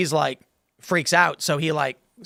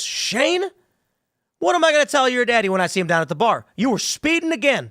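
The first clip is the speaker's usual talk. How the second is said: high-pitched scolding deputy who knows the family; small-town deputy; 8 semitones higher, 3 dB louder than usual. Acted. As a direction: call the kid out as a familiar local deputy. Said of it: "deputy comes up to the car".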